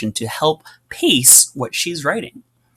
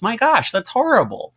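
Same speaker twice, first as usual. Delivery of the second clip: higher, aghast; mock horror; 12 semitones higher than usual. Real, not acted. Teacher mock horrified at the sentence.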